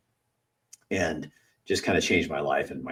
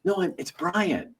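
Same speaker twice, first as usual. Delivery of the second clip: 1 annoyed correction; 12 semitones higher, slower than usual. Retold or imitated. Bully correcting again.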